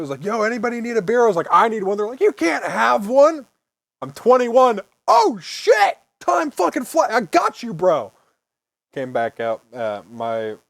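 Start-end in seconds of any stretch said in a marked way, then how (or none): 0.3-1.2 louder, higher party call; loud party guy. Retold or imitated. Voicing partygoer offering beer. 1.5-2.0 higher, louder eager answer. Retold or imitated. Eager reply about beer. 2.2-8.1 high, loud hyped-up bro; excited bro voice. Retold or imitated. Voicing partygoers reacting.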